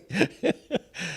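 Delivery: a short breathy laugh at her own joke; soft laugh. Real, not acted. Laughing at her own pretend retort, soft chuckle. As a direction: let a soft chuckle escape.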